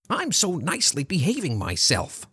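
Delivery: high-pitched, preening silly voice; silly squeak. A narrator's reading. Arthur strutting like a peacock, silly squeaky voice. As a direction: squeak it like a self-admiring show-off. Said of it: "he squeaked in a silly voice".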